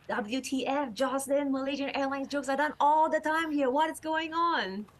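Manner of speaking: high, disbelieving friend voice; incredulous comedian friends. Retold or imitated. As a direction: ask it in shocked disbelief. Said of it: "comedians message me".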